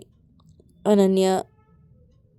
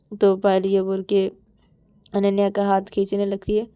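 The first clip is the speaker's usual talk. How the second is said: plain reading voice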